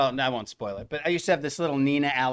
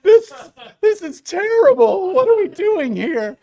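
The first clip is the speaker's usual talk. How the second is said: imitating his own crying; 13 dB louder than usual. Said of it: "I'm, like, crying and holding it".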